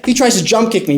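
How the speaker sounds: plain reading voice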